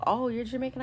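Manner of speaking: high-pitched, retold surprise; mock surprise. Reacting to an acquaintance at the nail salon, mock surprise.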